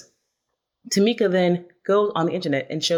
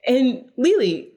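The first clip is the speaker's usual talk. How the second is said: mock exasperation; 8 semitones higher than usual. Mock exasperated rant at cast.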